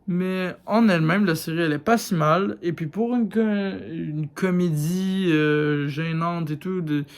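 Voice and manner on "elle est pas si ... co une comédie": ordinary delivery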